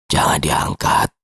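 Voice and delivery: slow, low, heavy rasp; Pak Yosep's hoarse voice. Acted. Sick colleague warning the guard off the phone, slow hoarse low voice. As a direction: drag the warning out in a deep, voiceless croak. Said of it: "Pak Yosep berkata lagi".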